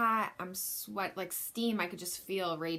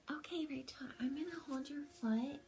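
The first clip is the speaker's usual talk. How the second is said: higher and softer, gentle midwife imitation; softly, like the midwife; 7 semitones higher, 6 dB quieter than usual. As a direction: soothe her like a tender midwife. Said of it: "she talks, like, really".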